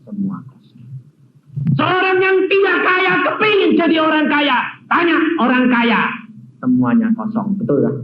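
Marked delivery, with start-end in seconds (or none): none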